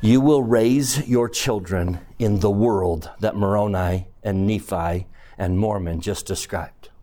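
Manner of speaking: ominous sermon tone; a little ominous. Acted. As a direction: warn the parents soberly. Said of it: "allow me to be a little ominous".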